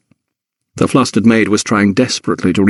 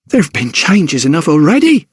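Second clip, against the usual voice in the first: higher, sharp retort from the maid; snapping maid; 6 semitones higher than usual. A narrator's reading. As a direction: snap it back at him. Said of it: "snapped Hannah".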